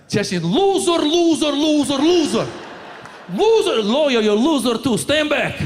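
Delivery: angry ranting shout. Retold or imitated. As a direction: rant like a furious uncle. Imitating uncle ranting.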